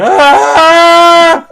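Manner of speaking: sudden high, loud panicked yelp; panicked scream. Retold or imitated. Man fleeing a bar, high loud panicked scream. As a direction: shriek and bolt from the room. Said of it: "goes running out of the room going".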